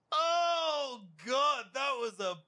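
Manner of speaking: very high, drawn-out cry of relief; elated shout. Real, not acted. Player who just survived a raid, elated shout. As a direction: let the relief burst out high.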